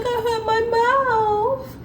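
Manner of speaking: put-on very high, slow, soft little-boy whimper; toddler voice. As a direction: play the small hurt child. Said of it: "He's like".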